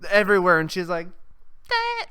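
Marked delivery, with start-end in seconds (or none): 1.7-2.1 very high mock frightened squeal; tiny high-pitched "eh" of alarm; scared little squeak. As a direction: squeak like a startled small animal. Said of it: "looks like a scared fucking animal".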